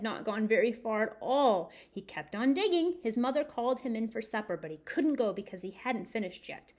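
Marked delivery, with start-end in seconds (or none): none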